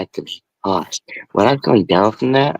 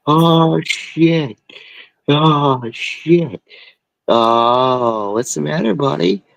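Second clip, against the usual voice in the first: a hint of a worried mother, higher; worried mom; 7 semitones higher, much slower than usual. Lightly voiced mom finds her son stoned on her bed. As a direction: coo over him like a startled mom.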